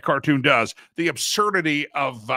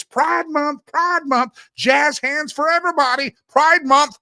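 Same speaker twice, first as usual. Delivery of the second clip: much higher, louder, jazz-hands sarcasm; mocking cheer; 9 semitones higher, 6 dB louder, slower than usual. Host mocking Pride Month with a sarcastic cheer. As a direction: sell it like a mock party.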